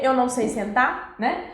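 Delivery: usual speaking voice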